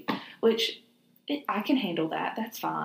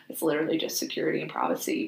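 normal talking voice

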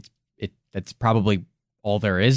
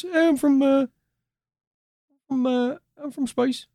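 high British voice for the alien; mock UK alien; 13 semitones higher than usual. Alien answering the pub invite in a high mock British voice. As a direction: squeak out a cheery British reply. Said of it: "our various UK accents".

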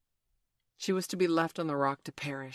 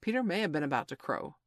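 plain reading voice

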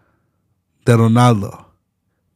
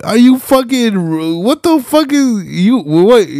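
incredulous outburst; 11 semitones higher, slower than usual. Real, not acted. Streamer baffled by his friend's claim, incredulous high outburst. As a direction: squeak out your outrage at the absurd take.

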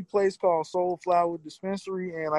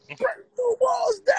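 high, slow screamed entrance call; wrestling catchphrase scream; slower than usual. Retold or imitated. Quoting Jericho's catchphrase.

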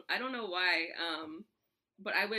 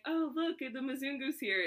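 teasing mother; 8 semitones higher, 3 dB louder than usual. Retold or imitated. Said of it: "they would be like".

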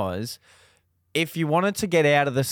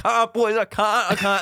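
high, pleading I can't; scared man begging his mates in the dark; panicked refusal; 6 semitones higher than usual. Retold or imitated. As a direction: whimper it to your mates. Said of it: "I'm like".

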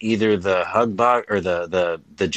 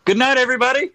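jokey falsetto good night; high squeaky sign-off; 4 dB louder, much faster than usual. Pretend. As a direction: say it high and silly. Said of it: "repeats sign-off differently".

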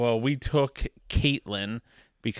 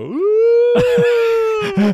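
mock sob wail, far higher and louder; mock crying wail; 12 dB louder than usual. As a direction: howl it like a hammed-up crybaby. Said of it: "Do you go".

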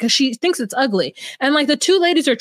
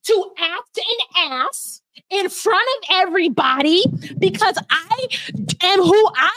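mock villain mother; 5 semitones higher than usual. Mocking Chevelle's mom defying everyone, hammed-up defiance. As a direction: ham up a proud petty mother. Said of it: "Chevelle's mother is like".

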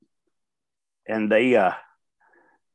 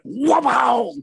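high mock crowd roar; crowd wow; 12 semitones higher, 4 dB louder than usual. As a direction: cheer like the whole arena. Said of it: "the crowd went".

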